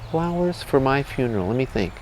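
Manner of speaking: soft, pondering voice; weak dying boy. Acted. Dying boy considers funeral flowers, soft weak voice. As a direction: play a feeble patient thinking it over.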